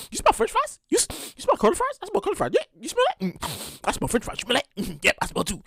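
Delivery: hushed nosy sniffing questions; sniffing stranger. Pretend. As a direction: lean in and murmur about the smell. Acts out people sniffing each other.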